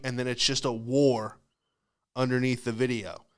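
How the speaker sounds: usual speaking voice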